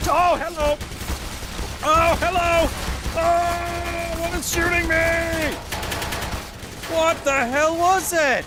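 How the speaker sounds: very high, loud cries of alarm; startled yelling. Real, not acted. Gamer shot by an unseen enemy, startled yelling. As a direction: yell it high, drawn out in shock.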